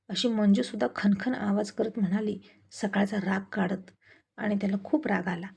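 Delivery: usual speaking voice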